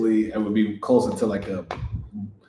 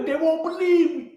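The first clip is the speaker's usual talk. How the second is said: put-on New York accent. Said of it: "New York accent".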